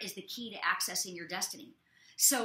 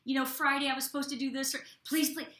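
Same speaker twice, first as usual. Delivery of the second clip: mock desperate begging; 3 semitones higher, 4 dB louder than usual. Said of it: "there's times they're like".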